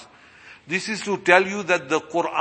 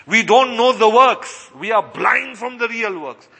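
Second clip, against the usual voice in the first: higher-pitched, forceful preacher voice; impassioned preaching; 3 semitones higher than usual. Acted. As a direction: drive each point home to the congregation.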